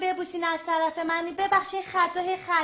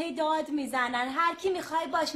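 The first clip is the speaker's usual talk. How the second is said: ordinary delivery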